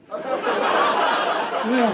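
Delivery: whispered Yeah through a laugh; breathy laughing yeah. Acted. Comic confirming the troll result, laughing.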